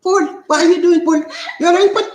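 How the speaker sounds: high, loud, through laughter; laughing tease. Real, not acted. Host teasing Paul over his name, laughing high. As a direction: crack up while calling his name.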